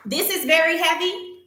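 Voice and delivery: high, rising disbelief on "very heavy"; incredulous. Real, not acted. Customer shows viewers her light package, incredulous. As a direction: question it as if it's absurd.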